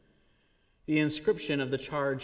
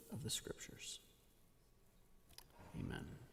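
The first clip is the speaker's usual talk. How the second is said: breathy closing prayer whisper; whispered blessing; 2 semitones lower, 18 dB quieter than usual. Real, not acted. Minister closing the reading, soft whispered blessing. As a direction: let the blessing fade to a whisper.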